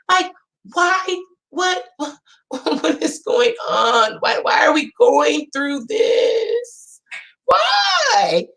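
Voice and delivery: slow, rising yells ending in a high cry; exasperated shout. Retold or imitated. Mom reliving her kids' fight, exasperated yelling. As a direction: throw your hands up and holler.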